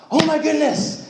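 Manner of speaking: high, exasperated exclamation. Retold or imitated. Leader reacts as the camp worker walks away, exasperated and high. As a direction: throw up your hands in disbelief. Exasperated after guy leaves.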